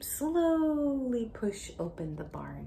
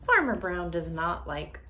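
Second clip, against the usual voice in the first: storybook reading; 3 semitones higher, 4 dB louder than usual. Acted. Teacher reading a Halloween book to a child, dramatic. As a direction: read it big and deliberate for a child. Reads story text aloud.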